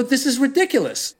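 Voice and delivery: high, incredulous scoff; mock exasperation. Comedian retells his own outburst.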